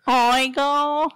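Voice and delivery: hammed-up Cuban cry; Cuban accent. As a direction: belt out a playful Cuban accent. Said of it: "in your best Cuban accent".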